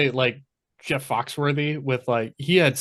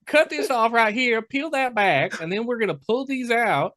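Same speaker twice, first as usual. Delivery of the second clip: exaggerated Southern twang, pitched way up; Southern accent; 8 semitones higher than usual. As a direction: play a Southern surgeon explaining the cut. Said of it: "your surgeon had a Southern accent".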